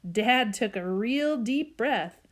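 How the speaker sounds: ordinary delivery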